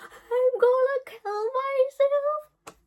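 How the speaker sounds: very high, loud, slow wailing; dramatic teen wail. Retold or imitated. Melodramatic teen wails to the roommates, very high and slow. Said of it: "being a dramatic teenager".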